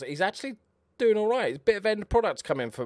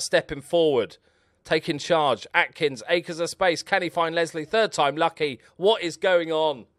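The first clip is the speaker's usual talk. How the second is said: excited play-by-play; 5 semitones higher, 6 dB louder than usual. Real, not acted.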